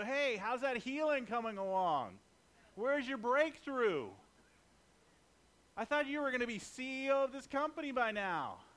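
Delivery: high, loud sneering taunt; taunting mocker. Satan's messenger mocking a struggling believer, high and loud. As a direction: needle them about their failures. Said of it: "Satan will sometimes send people".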